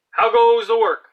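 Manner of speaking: high, loud call from the lazy farmer; bossy farmer calling. Acted. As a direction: bellow it like a lazy boss from bed. Farmer voiced.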